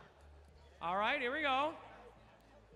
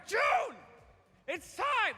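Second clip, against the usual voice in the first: calling out; 8 semitones higher, 6 dB louder, slower than usual. Real, not acted. Host calls winner onto stage.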